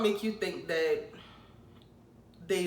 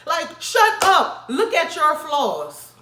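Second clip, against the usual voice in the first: higher, louder, slow "shut up"; annoyed shout; 8 semitones higher, 8 dB louder, much slower than usual. Acted. Telling Virgos off, slow loud shout. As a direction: snap at them to shut up.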